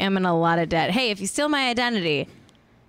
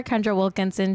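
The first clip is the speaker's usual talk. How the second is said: ordinary delivery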